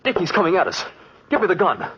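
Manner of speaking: rising frantic demand for the gun; panicked pleading. Acted. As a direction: beg for the gun as the killer comes. Panic as killer approaches.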